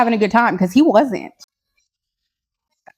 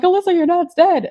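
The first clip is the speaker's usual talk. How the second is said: mom quoted; 8 semitones higher than usual. Retold or imitated. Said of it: "she was literally just like".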